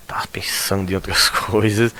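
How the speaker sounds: unvoiced teasing whisper. Real, not acted. Teacher teasing distracted students, whispering. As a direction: lean in and whisper the tease.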